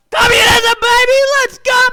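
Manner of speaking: very high, full-volume scream; hammed-up screaming hype; mock ecstatic cheer. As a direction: yell it like a frenzied fan. Russians acted cheering wildly.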